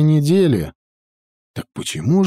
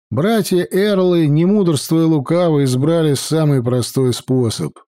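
usual speaking voice

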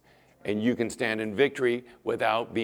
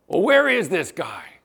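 demanding king; 5 semitones higher, 7 dB louder than usual. Retold or imitated.

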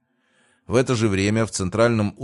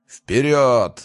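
loud, higher rallying roar; roared battle cry; 5 semitones higher, 7 dB louder than usual. A narrator's reading. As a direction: roar the troops into a charge. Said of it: "взревел ощутивший поддержку покровительницы Мортимер". (боевой рёв, громко и протяжно)

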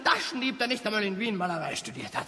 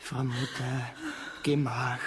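much lower and softer, calming; soothing; 10 semitones lower, 7 dB quieter than usual. Acted. Son calming his lamenting mother, low soothing voice. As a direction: hush her gently.

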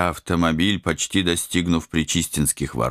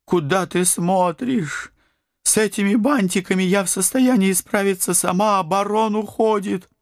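high, scolding vamp voice; angry female voice. Acted. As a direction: scold him sharply. Angry vampire woman scolds.

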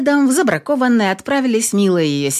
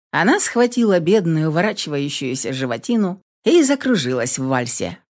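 usual speaking voice

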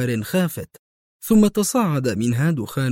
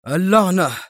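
louder, higher angry curse; raised-voice oath; exclaimed curse; 6 semitones higher, 5 dB louder than usual. A narrator's reading. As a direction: burst out cursing in frustration. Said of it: "هتف برنارد".

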